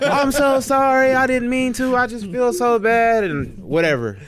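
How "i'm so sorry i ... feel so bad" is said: high, slow, loud mock remorse; hammed-up pleading lady; gushing apology imitation. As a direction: stretch out her wailing apology.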